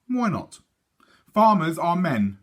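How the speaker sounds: a hint of know-it-all twins; teasing boys. Twins telling their sister off, mild boyish scoff.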